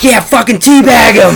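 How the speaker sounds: high, loud laughing shout; gleeful gamer yell. Retold or imitated. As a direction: cheer on the teabag. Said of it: "in the other room just screaming".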